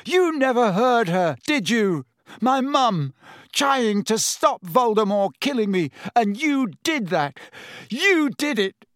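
high, loud, trembling accusation; shaking with rage. A narrator's reading. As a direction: voice cracking as he blames him. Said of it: "his voice shook more than ever".

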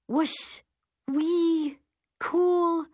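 high, drawn-out whooping exclamations; exhilarated. A narrator's reading. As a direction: whoop like you're soaring through air. Narrator thrilled while flying on broom.

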